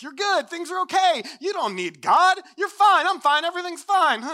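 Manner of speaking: loud, high, over-cheery self-reassurance; hammed-up pep talk, pitched high and insistent; mock pep talk. Fallen world reassuring itself without God, mock-cheery shouting. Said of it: "this big self-centered pep talk".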